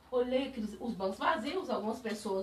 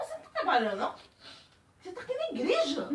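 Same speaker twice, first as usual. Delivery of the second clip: rising higher, faster, teasing; playful teasing; 7 semitones higher, much faster than usual. Retold or imitated. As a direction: tease her, voice climbing. (provocando, subindo o tom)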